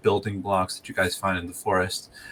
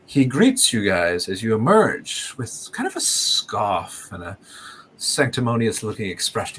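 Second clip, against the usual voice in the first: usual speaking voice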